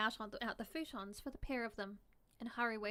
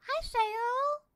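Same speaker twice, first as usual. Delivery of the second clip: much higher, loud cheerful hello; excited greeting; 14 semitones higher, 10 dB louder than usual. Real, not acted. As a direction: call out a happy hello to a viewer. Greeting a chat viewer.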